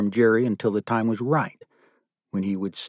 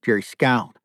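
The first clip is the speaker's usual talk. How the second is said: ordinary delivery, nothing marked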